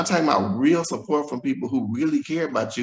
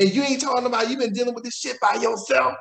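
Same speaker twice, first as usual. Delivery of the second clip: high, pressing reproach; friend's loving scold; 9 semitones higher than usual. Retold or imitated. Friend scolds Tony for facing HIV alone, high and reproachful. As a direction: scold him for hiding it, out of love.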